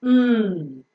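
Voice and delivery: "Mm" hummed long through the nose; nasal M sound. Shown as a demonstration. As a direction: lips closed, sustain "Mm". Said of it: "is a nasal sound pronounced through the nose".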